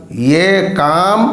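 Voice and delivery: usual speaking voice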